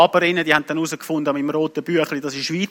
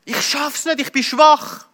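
high, drawn-out cry to God; acted wail of giving up; retold desperate cry; 6 semitones higher, slower than usual. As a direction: cry out helplessly, pitched well up. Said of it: "habe zu Gott geschrien".

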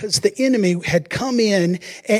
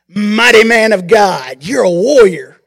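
loud, slow heavenly proclamation; proclaiming angel; 7 dB louder than usual. Acted. Angel hails frightened Gideon as warrior, loud and slow. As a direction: boom it out like an angel announcing destiny.

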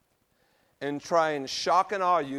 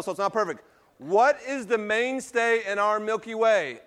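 ordinary delivery

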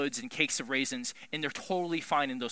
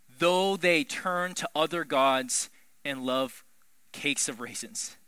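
slow, measured reading of the verse; "Though they turn to other gods" read slow and even; 3 semitones lower, slower than usual. Shown as a demonstration. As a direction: read it out slowly and plainly. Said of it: "reads the verse slowly".

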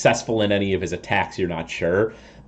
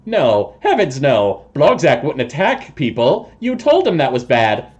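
slightly high toad voice, protesting innocence; evasive toad creature; 6 semitones higher, 4 dB louder than usual. Acted. Toad person denies attacking people, evasive and slightly high. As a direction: deny it as a shifty toad-man.

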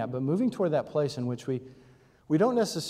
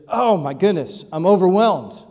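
louder, higher hammed-up overwhelm; over-the-top mock distress; 5 semitones higher, 10 dB louder than usual. Shown as a demonstration. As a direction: play the lunch choice as a crisis. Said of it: "And you said".

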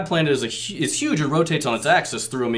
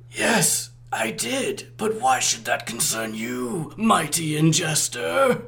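slow, scheming higher voice; sly villain voice; 4 semitones higher, slower than usual. Acted. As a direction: ask slyly and slowly. Megatron cunning reply.

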